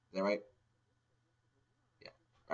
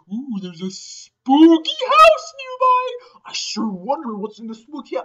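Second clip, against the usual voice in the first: high, very slow, louder drawn-out ooh; spooky teaser; 14 semitones higher, 11 dB louder than usual. Retold or imitated. NPC hinting at the spooky house, high and drawn out. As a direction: tease the haunted house like a campfire tale.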